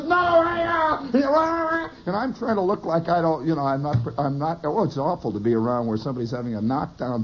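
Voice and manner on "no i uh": high, strained mock yell; yelling on phone. Friend behind me fighting on the phone, mock yelling. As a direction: holler it in a high, muddled rant. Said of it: "they're yelling".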